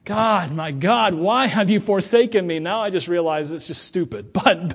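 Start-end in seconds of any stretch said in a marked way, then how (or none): 0.0-2.6 louder, very slow anguished cry to God; hammed-up anguish of a younger self crying to God; dramatic lament. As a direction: wail it out like a melodramatic psalm. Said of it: "I used to say that when I was younger".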